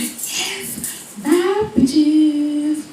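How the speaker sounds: whispered yes, then sing-song goodbye; mock triumphant kiss-off; gleeful farewell. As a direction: celebrate under the breath, then stretch out the bye. Child secretly rejoices leaving class.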